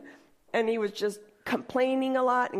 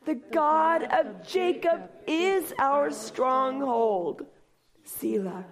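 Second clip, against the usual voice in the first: slow, measured reading of a psalm; solemn scripture reading; slower than usual. Acted. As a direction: read it out slowly like holy scripture.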